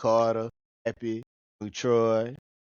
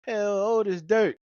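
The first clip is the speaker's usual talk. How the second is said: higher, slow tattling voice; snitching on dad; 7 semitones higher, 3 dB louder, slower than usual. Retold or imitated. Son snitching on his dad's age, slow high tattle. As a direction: drag it out, tattling on the old man. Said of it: "Hear him snitch on my dad".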